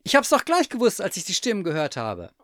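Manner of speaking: high, loud, then easing off; excited outburst. A narrator's reading. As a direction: burst out high, settle by the end. Overjoyed at the return.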